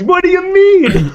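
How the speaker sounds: high, loud disbelief; can't believe what you just said; incredulous. Real, not acted. Incredulous comeback in debate.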